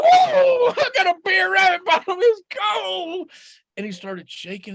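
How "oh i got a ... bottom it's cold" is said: very high, loud yelping rabbit voice; freezing Rabbit. Acted. Rabbit sits in icy water, high shrieking. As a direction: shriek at the cold.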